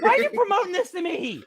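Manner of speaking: high-pitched comic wail through laughter; mock-hysterical high complaint; distressed protest. Repeats protest, more hysterical, laughing.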